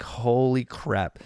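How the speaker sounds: hushed, drawn-out disbelief; quiet retold amazement; stunned. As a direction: stretch it out slowly, softly floored. Stunned reaction to the punchline.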